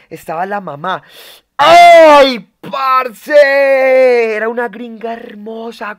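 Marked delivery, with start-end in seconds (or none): 1.6-6.0 long, high, very loud cry; ecstatic shout. Real, not acted. Streamer overcome by the gringa's beautiful mother, ecstatic yell. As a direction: holler it out, drawing both words long. Swooning over the girl's mother.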